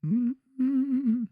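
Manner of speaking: high, drawn-out moaning hum. Retold or imitated. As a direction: hum it like an old lady savouring food. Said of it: "I hear".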